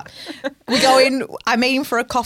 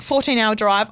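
ordinary talk

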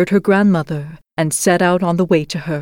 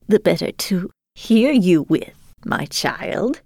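wolf playing granny; slower than usual. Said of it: "was the reply".

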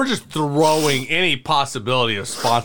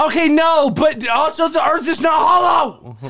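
exasperated shout. Real, not acted. Arguing with cohost over hollow earth, exasperated shout. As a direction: shout the objection in disbelief.

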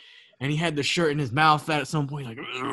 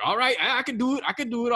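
eager Turtle; 8 semitones higher, faster than usual. Retold or imitated. Turtle voiced in story.

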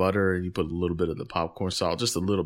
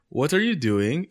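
light Jamaican; 6 semitones higher than usual. Retold or imitated. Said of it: "She's Jamaican".

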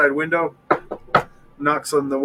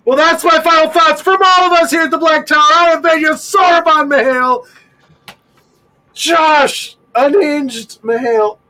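higher and louder manic outro; unhinged shouted sign-off; 10 semitones higher, 7 dB louder than usual. Real, not acted. Host yelling the show sign-off, manic shout. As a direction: bellow the outro, fast and wild.

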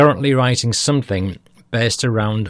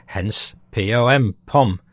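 ordinary delivery

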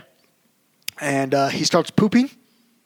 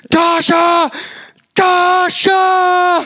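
high loud calling scream; screaming for wife; 8 dB louder, much slower than usual. Retold or imitated. Dad screaming downstairs to wife, loud high calling. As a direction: scream her name up the stairs. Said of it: "I'm just screaming for my wife".

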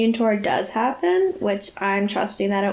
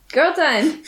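laughing; 3 semitones higher than usual. Real, not acted. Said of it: "I can hear you yelling girl time".

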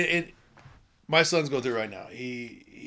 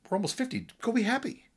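high-pitched mock exasperation; retold exasperated advice; 13 semitones higher than usual. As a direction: retell it as blunt, high-voiced advice. Retold blunt advice to friend.

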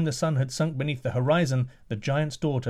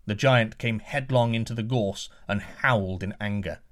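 plain reading voice

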